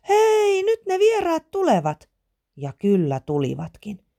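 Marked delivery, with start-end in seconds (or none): 0.1-2.1 very high, piping call; high little-girl holler; excited child calling. A narrator's reading. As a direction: shout the news down from up high. Child on flagpole calls out news.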